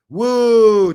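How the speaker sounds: long, high, loud wrestler whoop; Ric Flair woo. Retold or imitated. Host joking about taking kratom, Ric Flair whoop. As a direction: let out a drawn-out showman's "woo". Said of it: "Did you know Ric Flair".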